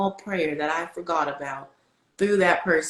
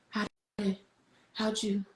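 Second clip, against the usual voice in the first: soft, higher, halting question; stunned disbelief; 4 semitones higher, 6 dB quieter than usual. Retold or imitated. Asking God how He knew, soft stunned voice. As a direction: falter quietly in amazement. Retold astonishment at God's answer.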